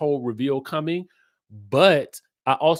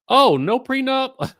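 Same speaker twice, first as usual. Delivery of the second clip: high, loud "oh, no prenup?" gasp; surprised; 12 semitones higher, 6 dB louder than usual. Real, not acted. Host reacting to chat's prenup news, surprised. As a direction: caught off guard by the news.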